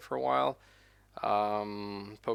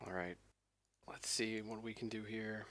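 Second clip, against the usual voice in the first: ordinary talk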